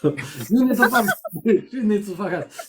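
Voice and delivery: put-on French accent through laughter; joking French accent, cracking up. Storyteller repeating the focus joke, laughing French accent. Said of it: "If I stop with a French accent".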